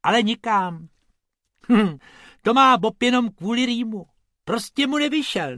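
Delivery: high, loud cartoon rabbit voice; high-pitched rabbit brushing it off; rabbit explaining. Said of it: "vysvětlil Bobek".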